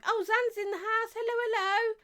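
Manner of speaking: high-pitched sing-out hello; excited greeting. Real, not acted. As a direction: welcome a viewer in a high excited voice. Greeting viewers as they join.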